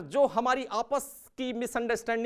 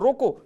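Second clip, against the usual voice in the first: रोको said louder and higher; firm raised रोको, falling at the end; command on रोको; 5 semitones higher, 5 dB louder, slower than usual. Shown as a demonstration. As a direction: call out रोको as an order. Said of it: "एक तरफ मैं बोल रहा हूँ".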